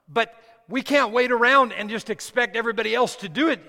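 loud, high, urgent sermon voice; rally the congregation with rising force; impassioned preaching. Acted. Preacher urging church not to wait around, loud impassioned preaching.

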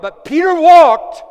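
Peter walked, shouted high and slow; triumphant shout. Real, not acted. Preacher to church, shouting triumphantly that Peter walked. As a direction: belt it out in triumph. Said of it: "preacher's climax".

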